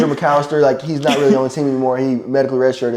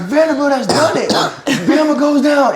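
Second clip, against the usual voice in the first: high, slow announcer shout; hammed-up play-by-play of the upset; mock broadcast call; 14 semitones higher, 3 dB louder, slower than usual. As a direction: belt it like a TV commentator.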